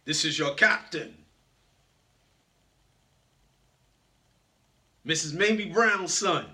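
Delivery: lightly voiced powerful captain over the speaker; a hint of a motivational speaker as pilot; Les Brown. Captain addressing his passengers over the speaker, lightly imitated powerful voice. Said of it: "in my Les Brown voice".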